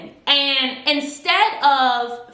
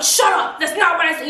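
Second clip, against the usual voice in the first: higher, mock angry shut up; a retold flash of temper, pitched up; imitating angry snap; 6 semitones higher than usual. Custer turning on Faye, higher mock anger. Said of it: "he's getting angry at this point".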